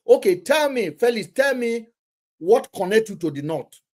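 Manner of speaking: ordinary talk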